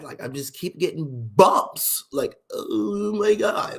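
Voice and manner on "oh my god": very slow, stretched mock dismay.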